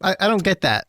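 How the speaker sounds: high, confused wait what; surprised. Real, not acted. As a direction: caught off guard. Confused by the quote.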